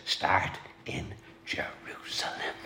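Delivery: hushed whisper on "Start in Jerusalem"; soft whispered "Start in Jerusalem", no voice. Retold or imitated. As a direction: breathe it out as a whisper, "Start" lifted. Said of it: "he emphasized this".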